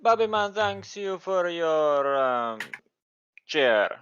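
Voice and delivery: put-on Jamaican accent. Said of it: "The worst Jamaican accent".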